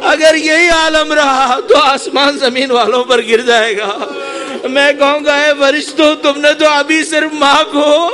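high, loud mourning cry building to a peak; full-force lament preaching. Real, not acted. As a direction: wail the martyrdom out to the congregation.

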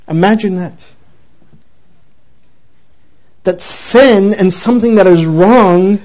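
a preacher leaning into the lesson; solemn preaching. Acted. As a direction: drive home the moral, measured and weighty. Preacher's closing exhortation.

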